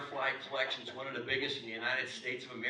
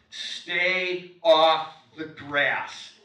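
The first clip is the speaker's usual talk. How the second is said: very loud, drawn-out order; stern shouted sign, every word stretched; booming command; 2 semitones higher, 12 dB louder, much slower than usual. Acted. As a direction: bellow it slowly like a strict rule. Voices a forbidding sign.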